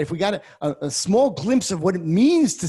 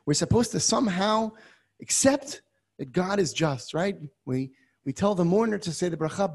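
ordinary talk

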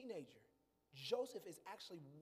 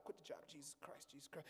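unvoiced, softer muttered despair; whispered despair; 5 semitones lower, 9 dB quieter than usual. Preacher reliving quitting, whispering in despair. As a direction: breathe it out in dismay. Despairing aside under breath.

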